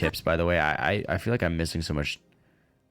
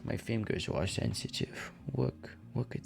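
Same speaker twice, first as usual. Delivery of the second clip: whisper; 9 dB quieter than usual. Real, not acted. Scratching itch, whispering relief.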